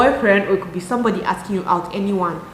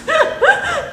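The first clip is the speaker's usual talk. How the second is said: high laugh. Real, not acted. Laughs at her own hand talk.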